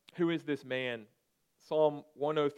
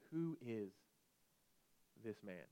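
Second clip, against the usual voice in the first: much softer, awed and frightened; softer and softer, awestruck disciples asking; hushed fear; 3 semitones lower, 11 dB quieter, slower than usual. Retold or imitated. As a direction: breathe it out in fear. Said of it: "again, afraid".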